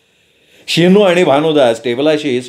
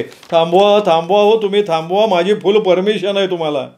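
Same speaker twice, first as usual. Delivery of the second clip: higher, eager pleading manager; 5 semitones higher than usual. A narrator's reading. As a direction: beg him to stop it, a touch higher. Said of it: "आदरानं बघत manager म्हणाले".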